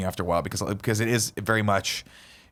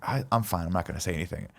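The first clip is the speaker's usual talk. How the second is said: meek mumble; 6 semitones lower, 7 dB quieter, faster than usual. Retold or imitated. Greg declining, meek.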